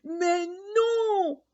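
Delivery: high roaring lion, indignant; lion's roar. Acted. Lion refusing the letter, roaring indignantly. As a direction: roar it out in protest.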